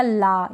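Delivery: ला with a long open "aa"; wrong aa vowel. Shown as a demonstration. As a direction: say ला as "laa", not "law". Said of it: "ये गलत हो जाएगा".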